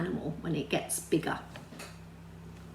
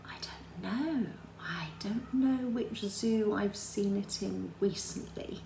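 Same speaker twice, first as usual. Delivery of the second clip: whisper; 7 dB quieter, slower than usual. Real, not acted. Muttered to herself.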